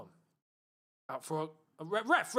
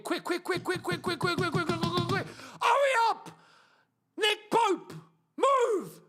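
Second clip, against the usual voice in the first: frantic shouting; 9 semitones higher, slower than usual. Real, not acted. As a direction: yell at the keeper to hurry. Urging keeper to hurry.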